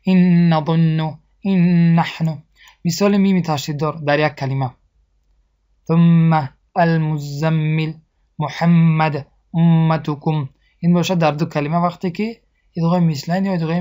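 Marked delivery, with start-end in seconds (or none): 0.0-2.4 این نحن recited slowly with a stretched, doubled n; merged nasal n. Shown as a demonstration. 5.9-10.5 slow recitation with a stretched m in محمد and امتکم; nasal two-count ghunna. Shown as a demonstration. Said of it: "نون و میم تشدید دار را با آواز دماغ".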